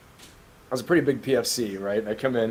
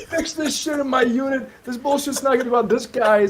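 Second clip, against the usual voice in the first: high, loud chewing-out; irate captain; 12 semitones higher, 3 dB louder than usual. Retold or imitated. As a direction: act out an officer's angry outburst. Acted captain berating fighting marines.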